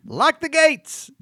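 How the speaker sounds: slow, high dramatic line delivery; Mark Maron. Shown as a demonstration. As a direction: hold every word, grand and slow. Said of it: "Watch this".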